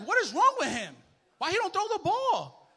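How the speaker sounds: loud, high-pitched fan outrage; exasperated yelling. Retold or imitated. Football fan yelling at his quarterback, exasperated. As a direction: yell in disbelief at a useless player.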